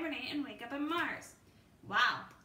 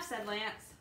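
ordinary delivery, nothing marked

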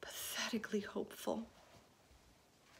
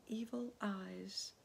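usual speaking voice

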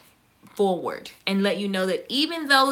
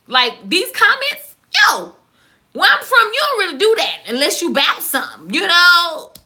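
heated rant; 5 semitones higher, 5 dB louder than usual. Real, not acted. Threatens commenters, heated.

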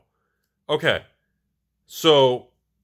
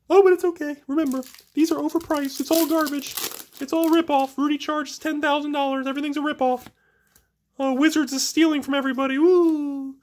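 much higher put-on voice parroting the complainers; mocking critic voice; faster than usual. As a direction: sarcastically mimic the whiners calling it all a ripoff. Mocking critics of pricey packs.

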